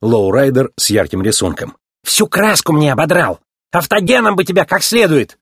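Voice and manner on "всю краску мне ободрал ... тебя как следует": higher-pitched indignant threat; angry scolding. A narrator's reading. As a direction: scold him over ruined paintwork. Ramon scolds offender over paint.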